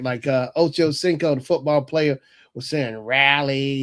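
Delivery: usual speaking voice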